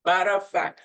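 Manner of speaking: ordinary talk, nothing marked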